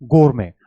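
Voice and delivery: गोरमे as "gor-may", final t silent; pronunciation demo. Shown as a demonstration. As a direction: say गोरमे clearly, ending in "may". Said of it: "आप इसे क्या बोलेंगे".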